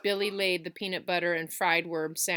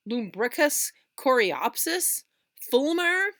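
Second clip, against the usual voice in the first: incredulous; 7 semitones higher, 6 dB louder, slower than usual. Acted. Tom repeating the letter's words.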